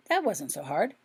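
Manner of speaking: usual speaking voice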